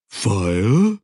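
a puzzled creature asking one word; troll voice, questioning. Acted.

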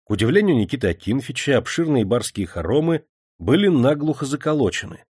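plain reading voice

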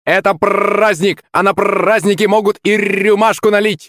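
high, squawking celebratory creak; creaky parrot. A narrator's reading. Parrot asking for a celebration drink, high creaky squawk. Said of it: "проскрипел он".

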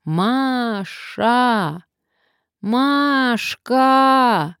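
high, drawn-out calling shout; loud shout. A narrator's reading. Nadezhda calls her missing friend among the ruins, loud shout. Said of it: "громко крикнула".

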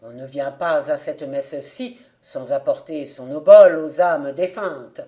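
low, loud, hard reproach; harsh tone. A narrator's reading. Beadle harshly demanding an offering from Marie, hard and loud. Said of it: "d'un ton dur".

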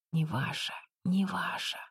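breathless eerie whisper; ghostly whisper. A narrator's reading. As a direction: whisper it like a threatening spirit. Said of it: "Огни снова зашептали". (призрачный шёпот)

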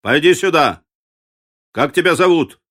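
loud, commanding call. A narrator's reading. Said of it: "воскликнул он".

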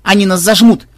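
panicked call to grandfather; alarmed shout. A narrator's reading. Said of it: "тревожно крикнула внучка".

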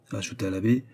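ordinary delivery

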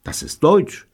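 high, startled gasp; high-pitched amazement. A narrator's reading. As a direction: gasp it out in astonishment. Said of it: "japste Robert".

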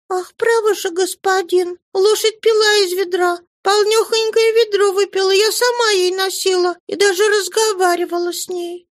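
put-on a frightened little girl insisting on her lie; little girl lying. A narrator's reading. Narrator voices young girl Cosette.

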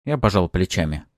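plain reading voice

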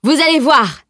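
high, loud promise of proof; defiant shout. A narrator's reading. Boaster about to produce his witness, defiant shout. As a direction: cry it out as a challenge. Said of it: "cria-t-il".

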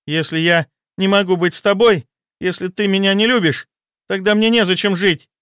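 high, despairing ultimatum; desperate mistress. Acted. Mistress with a scalpel at her wrist, high and desperate. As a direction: threaten him in raw desperation. Varvara threatens suicide with scalpel.

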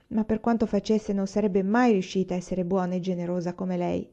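plain reading voice

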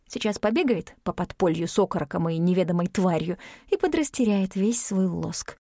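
usual speaking voice

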